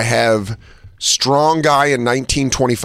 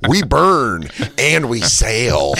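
very slow mock solemn; slow proud declaration; much slower than usual. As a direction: declaim it, drawn out. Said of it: "big pink skins".

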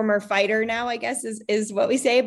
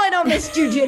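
louder, higher imitation of her husband; emphatic denial; 8 semitones higher, 5 dB louder, faster than usual. Imitating her husband refusing jujitsu, louder and higher. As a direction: keep the mock outburst going. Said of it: "He's like".

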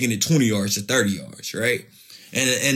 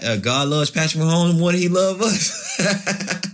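laughing joke; 6 semitones higher, slower than usual. Retold or imitated. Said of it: "I said".

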